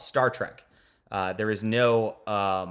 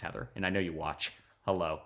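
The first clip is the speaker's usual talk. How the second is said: ordinary delivery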